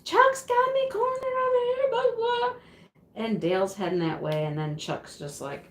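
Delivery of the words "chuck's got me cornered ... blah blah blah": very high wailing cry for help; mock yelling. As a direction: imitate a panicked screech, way up high. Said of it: "she just was yelling for Dale".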